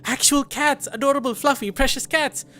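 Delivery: high, excited outburst about cats; ranting in mock outrage, voice shooting up; worked up and squeaky, insisting on real cats. Character rants about real cats.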